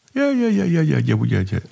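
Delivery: brushing off the priest's question with quick yeses; imitating eager parishioner. Parishioner dodging the priest's question, eager high yeahs.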